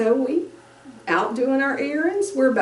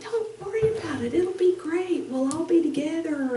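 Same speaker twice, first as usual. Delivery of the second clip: reassuring; 7 semitones higher, 4 dB quieter than usual. Retold or imitated. Parents reassuring son.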